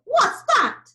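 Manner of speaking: high, loud alarmed question; startled loud lion. A narrator's reading. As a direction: shout out the sudden noise.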